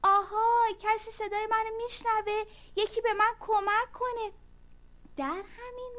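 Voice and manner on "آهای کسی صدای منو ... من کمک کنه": loud, high calling out; shouting for help. Acted. Ant yelling up the stone wall for help. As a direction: call out to anyone who can hear. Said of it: "فریاد زد".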